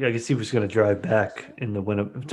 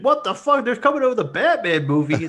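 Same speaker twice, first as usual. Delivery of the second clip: amazed exclamation; 10 semitones higher than usual. Retold or imitated. Acting out an imagined amazed reaction.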